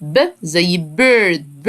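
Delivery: ب stretched very slowly before bird; slow phonics demo. Shown as a demonstration. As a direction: draw out ب زي bird slowly, letter by letter. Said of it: "اوعى تخرج هوا".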